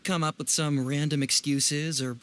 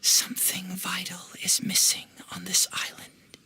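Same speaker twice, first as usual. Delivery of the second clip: eerie echoing whisper. Retold or imitated. Narrator voicing the dream's echo as a whisper. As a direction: breathe it like a distant echo. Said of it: "an echo resounding from the depths".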